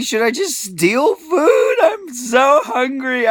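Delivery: begging through tears, slow and strained; desperate pleading cry. Retold or imitated. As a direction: voice breaking with hunger and despair. Pleading with store lady, starving.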